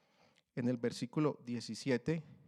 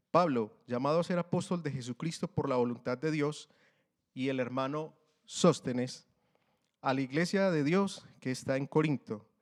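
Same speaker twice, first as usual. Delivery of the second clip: louder, solemn reading of the Bible; scripture reading; 6 dB louder than usual. Acted. Preacher reads the greeting to the church, louder and solemn. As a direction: proclaim the verses to the congregation.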